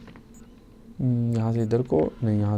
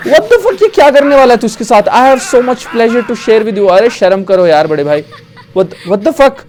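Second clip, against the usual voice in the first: high, loud outraged disbelief; shocked shouting; 12 semitones higher, 11 dB louder than usual. Real, not acted. Gamer appalled at schoolkids' behaviour on screen, shocked shouting. Player shocked by game scene.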